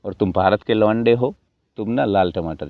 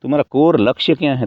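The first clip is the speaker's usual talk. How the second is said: ordinary talk, nothing marked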